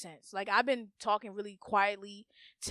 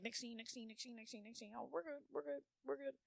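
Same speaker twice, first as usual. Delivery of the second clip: very soft rapid self-talk; dismissive inner voice; 16 dB quieter than usual. Acted. Her mind skipping past feelings, hushed dismissive chant. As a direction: repeat it under the breath to move along.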